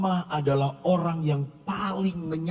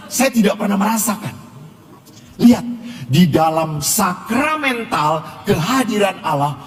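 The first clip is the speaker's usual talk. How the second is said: raised, insistent preaching voice, higher than his storytelling; 4 semitones higher, 9 dB louder than usual. Acted. Priest urging his congregation, preaching at full force. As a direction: drive the lesson home to the congregation.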